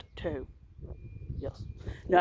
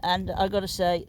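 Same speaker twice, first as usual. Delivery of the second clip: usual speaking voice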